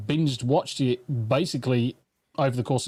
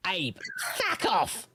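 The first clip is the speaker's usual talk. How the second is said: breathy high faux-actress line, no voice behind it; a hint of an actress playing coy; best actress voice; 5 semitones higher, 7 dB quieter, slower than usual. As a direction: deliver it like a starlet on cue. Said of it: "in your best actress voice".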